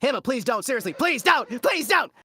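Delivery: very high, loud begging; mock frantic plea. Pleading with Emma to stop, mock frantic and high. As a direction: beg in an exaggerated high squeal.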